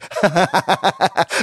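high ringing burst of laughter. Acted. Ariana laughs brightly at the princess. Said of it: "Звонко рассмеялась она".

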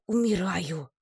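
high, strained exhausted wail; hoarse gasp. A narrator's reading. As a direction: rasp it out as if spent. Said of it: "прохрипела я".

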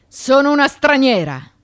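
loud, high cry of protest; outburst. Acted. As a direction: fling it out in defiance. Defiant outburst about accusation.

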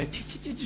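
quick dry spitting sounds; soft, voiceless "tü" spits; imitated spitting. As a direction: mime spitting over the shoulder. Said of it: "soluna tükürüyor".

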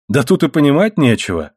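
usual speaking voice

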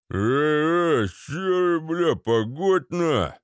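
mock yell in a put-on voice; yelling thug. A narrator's reading. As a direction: bawl slowly in protest like a panicked thug. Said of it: "заорал Вован".

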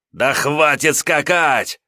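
slow, high angry growl. Acted. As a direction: growl it out slowly in frustration. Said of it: "рычал он". (рычит с досадой)

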